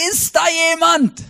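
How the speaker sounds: high desperate call into the void; a climber hanging over a cliff, crying for help. Acted. Stranded climber calling into the abyss, high desperate cry. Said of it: "dann schreit er".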